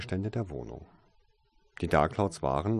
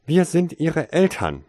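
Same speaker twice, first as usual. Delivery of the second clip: loud, sharp retort; sharp reply; 10 semitones higher, 10 dB louder than usual. A narrator's reading. Parents outside, sharp loud answer to Tasein. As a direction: snap it back hard. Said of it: "kam die erwartete scharfe Antwort".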